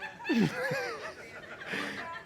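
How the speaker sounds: breathless high-pitched giggle. Real, not acted. Pastor cracking up at his son's gas story, high giggle. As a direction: let a squeaky laugh escape.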